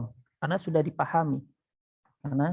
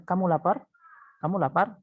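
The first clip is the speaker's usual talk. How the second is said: "Kamu lapar" with a lifted, questioning lapar; questioning intonation; 3 semitones higher than usual. Shown as a demonstration. Teacher showing how people ask daily, questioning intonation. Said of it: "itu udah intonasi bertanya".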